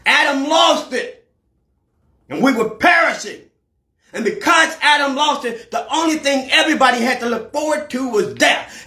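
rising, shouted sermon climax; preaching at full force. Acted. Preacher building to a cry before the congregation.

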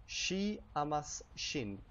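slow and deliberate; slow Esperanto demo. Shown as a demonstration. Teacher to viewers, slow model phrase. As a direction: let each word land for the learner. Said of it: "how would you say she loves her?".

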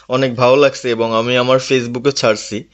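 ordinary delivery

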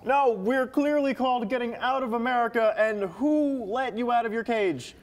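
high, indignant telling-off; exasperated scolding. Acted. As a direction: scold an intruder who crashed your show. Host scolds intruder in sketch.

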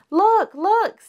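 high, loud cry of look; excited shout. A narrator's reading. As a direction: cubs spotting a new toy. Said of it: "shouted the cubs".

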